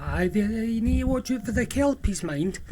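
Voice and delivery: lilting old islander, lightly voiced; Scottish lilt. Old man teasing the visitor about kelpies, lilting Scottish. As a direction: warn of kelpies with a soft Scots lilt. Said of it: "it had a lilt and it was soft".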